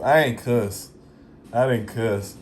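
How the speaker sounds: ordinary talk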